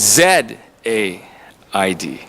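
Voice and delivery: slow letter-by-letter spelling of the name; spelled out. Shown as a demonstration. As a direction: spell Z A I D out slowly and clearly. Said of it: "My name begins with the letter Z".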